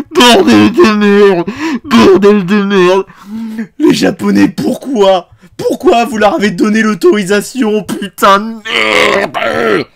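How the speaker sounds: a ranting reviewer losing it at the licensors, furious shout. Real, not acted. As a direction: yell it at the Japanese publishers in outrage. Raging at the bad translation.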